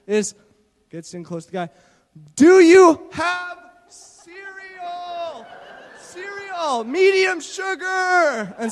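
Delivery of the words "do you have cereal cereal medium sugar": very slow, loud, high shouted words; slow exaggerated shout. Teammate mimicking Mark's third try yelling at the clerk. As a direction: yell every syllable as if volume helps. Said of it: "his third attempt is".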